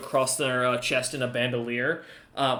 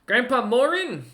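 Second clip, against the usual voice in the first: ogre's voice; 6 semitones higher than usual. Acted. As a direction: speak down from the wall, wary but polite. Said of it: "the ogre kinda looks back again and goes".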